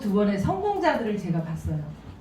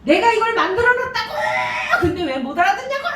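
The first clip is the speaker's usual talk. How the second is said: tearful outburst; 10 semitones higher, faster than usual. Retold or imitated. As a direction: imitate a speaker pleading in frustration. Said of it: "울면서 얘기하세요". (울먹이며 외치는 흉내)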